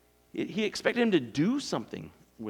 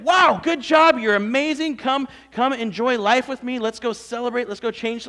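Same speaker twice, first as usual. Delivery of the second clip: louder, higher, beaming praise for a servant; gushing praise; 6 semitones higher, 8 dB louder than usual. Acted. Master praising his faithful servant, gushing and loud. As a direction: lay the praise on thick, bright and loud.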